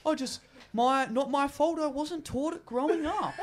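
high, whiny, laughing mock excuse; mock the friend's feeble excuses. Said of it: "And he's like".

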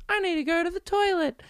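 put-on very high-pitched talking baby doll; little baby voice. Doll announcing it needs to go, little baby voice. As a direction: squeak it out as a tiny baby. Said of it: "it says in a little baby voice".

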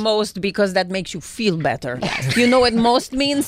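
put-on hammy Russian villain accent. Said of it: "Russian spy".

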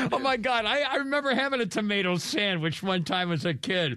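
high, laughing nostalgia. Real, not acted. Laughing about tomato sandwich.

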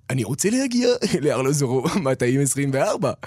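polished Hebrew in a radio-style voice. Shown as a demonstration. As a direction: say it like a radio presenter to hide your accent. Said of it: "la même phrase".

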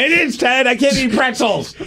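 high, whiny lament; mock tragic. Real, not acted. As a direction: play it up as tragedy. Mock tragic lament about bread.

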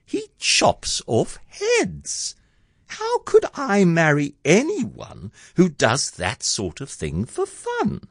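high, hesitant dismay; Cindy's hammed-up heartbroken wondering. A narrator's reading. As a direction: muse in a high voice, fading at the end. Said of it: "Poor Cindy's heart was torn to shreds".

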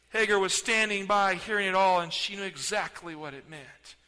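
ordinary delivery

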